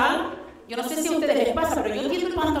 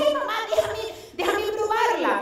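put-on child voice; 7 semitones higher than usual. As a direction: beg like an eager kid. Young son begging.

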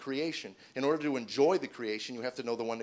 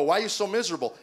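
higher, louder quoted question; puzzled questioning; 6 semitones higher, 7 dB louder, faster than usual. Retold or imitated. People asking the comedian why he's miserable, puzzled. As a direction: ask it loudly, pitched up.

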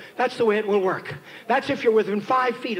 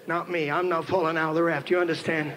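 low, soft, self-assured father's undertone; cocky aside; 8 semitones lower, 4 dB quieter than usual. Retold or imitated. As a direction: confide it to your daughter, quietly sure.

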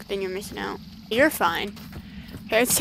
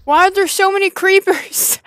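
scared; 8 semitones higher, 10 dB louder than usual. Real, not acted. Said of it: "I'm already scared".

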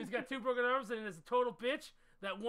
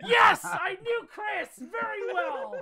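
higher, louder, slow triumphant yell; gloating shout; 9 semitones higher, 9 dB louder, slower than usual. Real, not acted. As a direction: crow over the win, drawn out. Gloating after winning the round.

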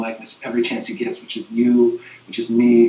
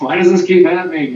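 raised pitch and louder, a retold cry of frustration to the Lord; exasperated plea; 6 semitones higher, 7 dB louder than usual. Priest recalling losing another teen, exasperated plea. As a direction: throw up your hands, asking why again.

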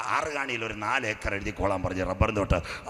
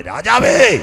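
calling out; 7 semitones higher, 12 dB louder, much slower than usual. Acted. Esther calls out to king.

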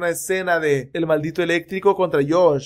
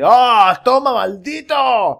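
loud, high, slow war cry; hammed-up hero shout; battle cry; 6 semitones higher, 7 dB louder, much slower than usual. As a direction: yell it as you strike. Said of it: "Y dice".

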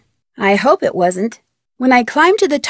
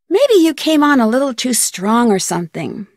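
ordinary talk, nothing marked

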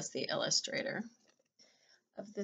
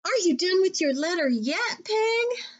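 higher, louder, eager rabbit voice; impatient Rabbit; 10 semitones higher, 5 dB louder than usual. Acted. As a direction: Rabbit nudging Pig to hurry. Rabbit voiced in a read-aloud.

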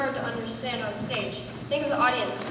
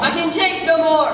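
loud, higher theatrical despair; melodramatic declaiming; 4 semitones higher, 10 dB louder than usual. Acted. Hero acts out the writer's line, melodramatic. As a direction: belt it out as a tragic hero.

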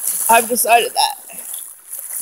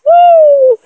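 excited whoop; 13 semitones higher, 9 dB louder than usual. Real, not acted. Excited cheer outdoors.

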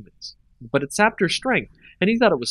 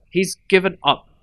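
higher, louder bitter bite; harsh, ground out; 6 semitones higher, 4 dB louder than usual. A narrator's reading. Nissa bitterly dismissing Jace, harsh and ground out. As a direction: grind the words out harshly. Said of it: "her voice harsh".

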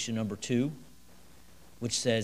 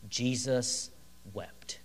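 solemn hush; 3 semitones lower, 8 dB quieter, much slower than usual. Acted. Shortest verse, solemn reading.

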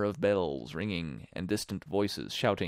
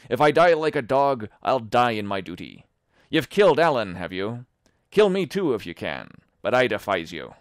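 loud, raised defiance; defiant shout; 2 semitones higher, 8 dB louder than usual. Acted. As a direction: hurl defiance at the killer. Tom defies Silver.

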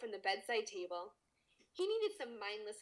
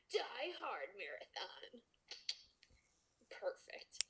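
whispering; 7 dB quieter, slower than usual. Real, not acted. Whispering during reading.

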